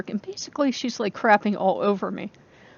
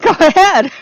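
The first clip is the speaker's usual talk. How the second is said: much higher and louder, egging him on; gleeful go-ahead; 10 semitones higher, 12 dB louder than usual. Retold or imitated. Telling him to go take revenge, high and gleeful. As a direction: cheer him on with delight. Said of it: "I'm like".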